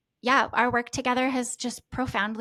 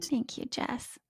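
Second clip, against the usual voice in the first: choked thanks; 5 dB quieter, slower than usual. Real, not acted. Moved client thanks coach.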